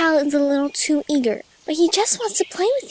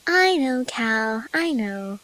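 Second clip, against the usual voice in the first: soft and lower, soothing; reassuring; 5 semitones lower, 4 dB quieter, slower than usual. A narrator's reading. Fluttershy reassures frustrated Cal, softly soothing. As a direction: comfort a child gently. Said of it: "Fluttershy assured him".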